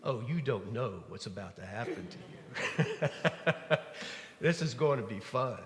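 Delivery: chuckling through the words; Jesus chuckling at Peter, amused; amused, laughing. Retold or imitated. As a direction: tease him with a knowing laugh. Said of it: "I just see Jesus laughing a little bit".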